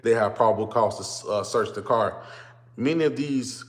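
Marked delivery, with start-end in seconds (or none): none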